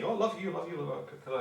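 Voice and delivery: a lightly voiced gushing relative; hint of an over-affectionate in-law. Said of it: "they're constantly".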